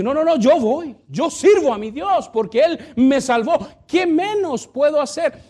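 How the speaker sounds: high, loud, eager voice of a willing servant. Retold or imitated. Pastor voicing a joyful servant, eager. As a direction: sing out the joy of serving God. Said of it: "Aquel que dice".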